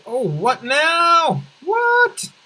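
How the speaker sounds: high, drawn-out triumphant crow; over-the-top celebratory outburst; hyped boasting. Pretend. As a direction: crow it with rising, stretched vowels. Crowing at the city's population.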